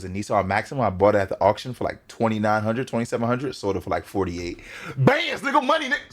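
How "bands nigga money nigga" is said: high-pitched joking blurt; playful outburst. Pretend. Reactor interrupts about car resale money, playful outburst. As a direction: burst out in a high hype voice. Blurts a joke, then apologises.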